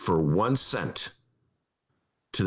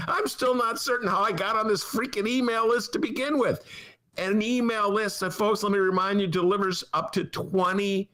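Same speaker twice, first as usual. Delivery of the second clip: much higher, worked-up ranting; exasperated rant; 12 semitones higher than usual. Real, not acted. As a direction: vent your disbelief at full pitch. Exasperated rant about email list.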